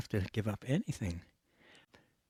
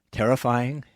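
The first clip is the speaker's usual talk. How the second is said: usual speaking voice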